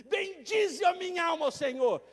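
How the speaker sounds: exultant repeat of the psalm; proclaimed psalm. Acted. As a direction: proclaim it to the rafters. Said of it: "ele começa assim".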